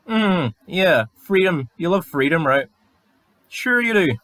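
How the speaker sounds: high, mocking "Sure you do"; sneering sarcasm at a freedom-lover; sarcastic. Real, not acted. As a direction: drip disbelief on it. Sarcastic reply to troll.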